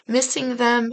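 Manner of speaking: ordinary talk, nothing marked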